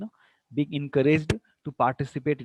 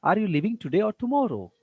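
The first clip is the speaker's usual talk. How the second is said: "today" goes up, "tomorrow" comes down; rise to fall; 5 semitones higher than usual. Shown as a demonstration. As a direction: offer a choice, lifting "today" and dropping "tomorrow". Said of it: "rise to fall intonation".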